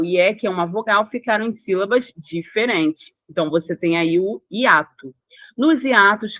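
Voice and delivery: ordinary delivery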